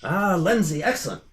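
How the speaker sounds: high, hearty host's call; eager welcome. Acted. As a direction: beckon a guest eagerly to the table. Host calls guest to eat.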